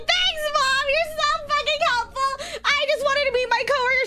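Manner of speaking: high-pitched, whiny sarcasm; sarcastic retort. Retold or imitated. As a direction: whine back at mom sarcastically.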